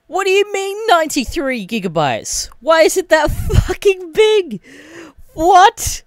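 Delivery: very high, loud shriek of disbelief; outraged yelling. Retold or imitated. Gamer demanding why the install is so big, outraged yelling. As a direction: yell it at the top of your range, laugh breaking in.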